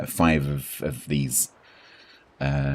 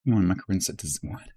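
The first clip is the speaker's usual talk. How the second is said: ordinary delivery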